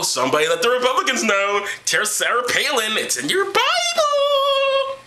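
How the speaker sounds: slow high singsong climbing into a long squeal; mock calling out. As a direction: call out mockingly, rising to a drawn-out falsetto.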